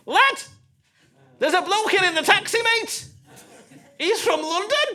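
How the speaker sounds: high, loud Scouse cabbie on a call; hammed-up excited Scouse; Scouse taxi driver. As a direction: holler the news down the phone in Scouse. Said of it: "Taxi driver".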